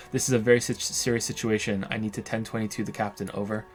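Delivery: ordinary delivery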